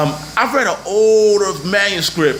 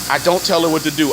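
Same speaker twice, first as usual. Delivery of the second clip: ordinary delivery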